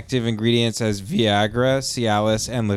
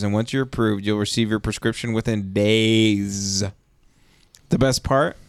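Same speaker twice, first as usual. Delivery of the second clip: upbeat sponsor read. Acted. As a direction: sell it like an ad.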